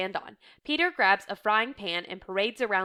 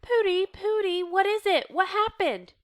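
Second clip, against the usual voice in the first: high-pitched, worried questioning; alarmed concern; 11 semitones higher, 4 dB louder than usual. A narrator's reading. Reader voicing a wife to her sobbing husband, alarmed. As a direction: plead to know what is wrong.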